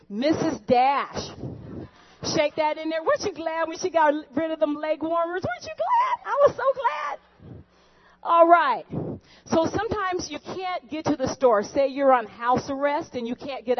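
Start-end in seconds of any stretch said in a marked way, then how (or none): 5.4-7.6 high squealing gush, way up in pitch; gushing host. Acted. Cooking show host squealing to her audience, giddy.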